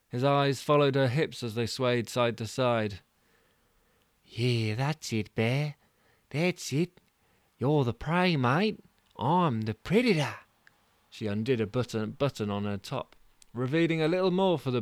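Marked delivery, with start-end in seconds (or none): 4.2-10.4 lightly voiced taunt to the bear; Mudguard teasing.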